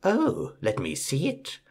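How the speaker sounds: a hint of a wheedling witch; witch begging. A narrator's reading. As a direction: coax it sweetly, sly underneath. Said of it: "the witch begs".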